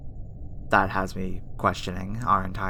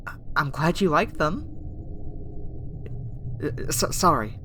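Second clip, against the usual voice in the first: hesitant stammer, slightly raised pitch; flustered; 3 semitones higher than usual. Acted. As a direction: trip over the words, embarrassed by praise. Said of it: "uh, flustered me".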